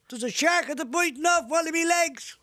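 high shouted call up from below. Retold or imitated. Mick shouting up to Paddy about the shark. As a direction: shout up in alarm. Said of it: "Mick shouts up".

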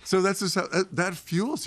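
laughing through the words; high laugh that spills into the line; words carried on a real laugh. Real, not acted. Laughs at joke.

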